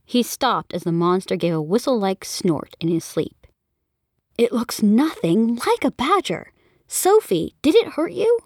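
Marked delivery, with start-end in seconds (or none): 4.4-8.4 mom alarmed. Acted. Mother alarmed for her daughter.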